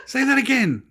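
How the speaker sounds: very high, loud mock demand; hammed-up excited insistence; retold fascinated woman. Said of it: "She said".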